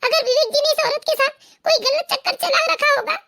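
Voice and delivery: crying through the words; tearful. Acted. Wife telling her mother-in-law of the affair, tearful. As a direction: plead your case through tears. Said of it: "रो क्यों रही हो?".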